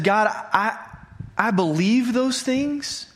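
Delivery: halting, slow plea to God; earnest prayer. Retold or imitated. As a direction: pray it slowly, feeling for the words. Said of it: "Have you ever prayed something like that?".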